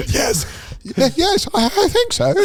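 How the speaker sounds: very high falsetto at the limit; strained high voice. Retold or imitated. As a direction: squeeze out the top note. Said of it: "pushing it to the limit".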